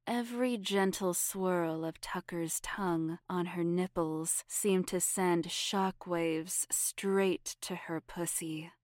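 ordinary delivery, nothing marked

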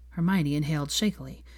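ordinary delivery

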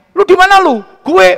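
poking fun at a Solo man posing as Jakartan; show-off Jakarta slang. Retold or imitated. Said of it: "telepon sama adiknya".

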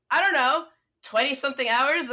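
high, loud brush-off; retold defiance. Traveller answering doubtful roommate about the drive, retold defiance. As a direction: toss it back high and loud, re-enacting.

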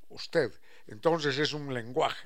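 usual speaking voice

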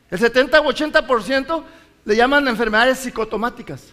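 usual speaking voice

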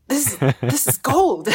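thrilled, savouring each word; gleeful realisation. Retold or imitated. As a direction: marvel at your lucky discovery. Delighted at her own success.

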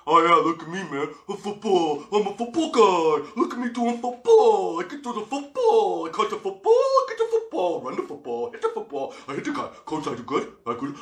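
high, loud, dopey caricature of a football meathead; hammed-up dumb football guy voice; mocking dumb jock. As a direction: taunt the jock by playing him as a dimwit. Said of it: "my mouth starts going".

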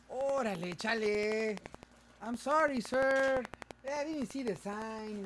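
high, drawn-out Chicano street voice; Chicano street accent. Acted. Co-host facing a judge over a ticket, Chicano accent. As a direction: stretch it out in exaggerated Chicano English. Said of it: "now imagine me walking in there".

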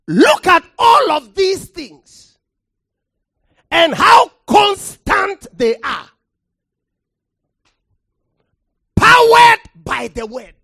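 loud, rising pulpit delivery, hammering the word; preacher thundering from the pulpit; full-force preaching. Acted. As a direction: preach it hard to the congregation.

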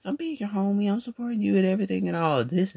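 quoting himself to an ungrateful friend; high indignant self-quote. Retold or imitated. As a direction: plead your loyalty. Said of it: "I'm like".

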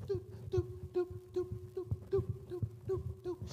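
high, steady checkout beep; high-pitched electronic blips by mouth; imitated scanner beeps. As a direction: mimic a register scanner, "doop, doop". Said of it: "those new beeper checkouts".

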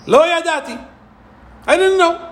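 high, singsong feigned innocence; mock Bilam's lame excuse; Bilam's slogan, whined high; mock innocent plea.

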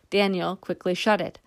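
usual speaking voice

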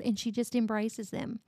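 usual speaking voice

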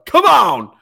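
high, very loud commentary yell; imitated yelling. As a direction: holler like a ringside manager, hammed up. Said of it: "She's yelling".

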